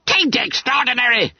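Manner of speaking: high-pitched schoolboy retort; indignant yell. A narrator's reading. Bunter denies his face is odd, yelling indignantly. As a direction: squeak it out hotly. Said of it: "yelled the indignant owl".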